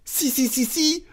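very high, squeaky insistence; agitated servant, pitch sky-high; frantic crying out. Acted. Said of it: "s'écria Passepartout".

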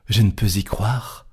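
ordinary delivery

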